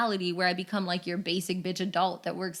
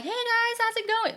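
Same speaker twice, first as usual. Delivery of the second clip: much higher, breezy waitress greeting; casual server voice; 13 semitones higher than usual. Retold or imitated. Host to guest, imitating a breezy server greeting. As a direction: chirp it like a friendly server. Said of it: "I was just kinda like".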